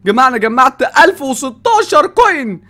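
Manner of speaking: high, loud, slowed proud announcement; excited boasting. Real, not acted. Youtuber tells viewers his coin total, excited boasting. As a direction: show off the number to the viewers.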